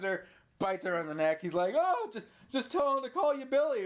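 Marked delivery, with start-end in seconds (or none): 1.8-3.9 high, hammed-up quote; mock jeering cry. Retelling the husband's jeer at Billy, high mock cry. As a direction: call it out high, imitating his taunt. Husband mocks wife as she dies.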